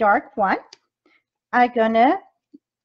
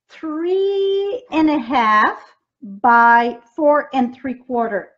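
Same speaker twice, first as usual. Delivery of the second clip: slow and deliberate, each number drawn out; slow dictation; slower than usual. Real, not acted. Crafter reading card size slowly to viewers, drawn-out dictation. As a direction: spell out the measurement slowly for viewers.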